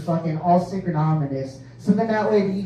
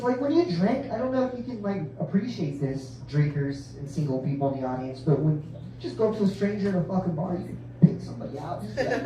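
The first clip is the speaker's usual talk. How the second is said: ordinary delivery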